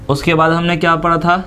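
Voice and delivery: normal talking voice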